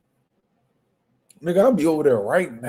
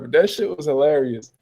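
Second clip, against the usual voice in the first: ordinary delivery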